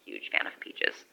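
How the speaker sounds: dry whispered aside. Real, not acted. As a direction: drop to a whisper, deadpan.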